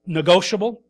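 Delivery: ordinary delivery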